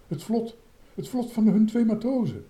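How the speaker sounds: high, amazed cry of discovery; excited high-pitched recognition; startled exclamation. A narrator's reading. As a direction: cry out at the sudden sighting. Startled at suddenly seeing the raft.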